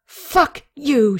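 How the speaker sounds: furious parting shot; angry curse. A narrator's reading. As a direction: spit it out at him in anger. Katie curses, throwing flag.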